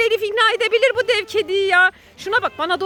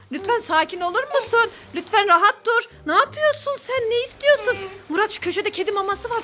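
usual speaking voice

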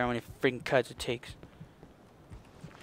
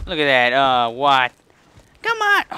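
furious shout; 11 dB louder than usual. Real, not acted. Player raging at a failed jump, loud furious shout. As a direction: blast it at the screen in disbelief.

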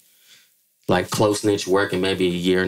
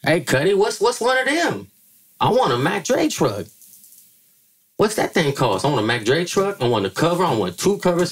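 [name] voice pitched much higher, eager; imitating [name]; 8 semitones higher than usual. Rapper asking the publisher for covers, high eager mimicry. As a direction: playfully mimic him demanding two covers. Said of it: "And [name] was like".

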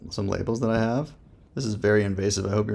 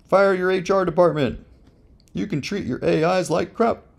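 cheeky excitement, voice jumping higher; excited joking; 9 semitones higher than usual. Real, not acted. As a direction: pitch it up like a gleeful pitchman. Joking hype about the AI.

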